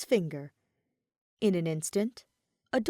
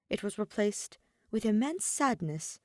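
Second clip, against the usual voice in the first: ordinary delivery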